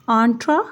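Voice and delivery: आंट्रा with open "aan"; correct pronunciation. Shown as a demonstration. Teacher modelling the first part of entrepreneur. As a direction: say आंट्रा with a broad nasal a. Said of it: "आ की निकालेंगे".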